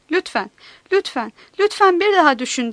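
plead, pushing harder on each repeat; pleading whine. A narrator's reading. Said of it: "diye sızlandı Reginald". (yalvaran, sızlanan bir ses)

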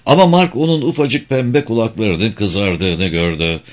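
usual speaking voice

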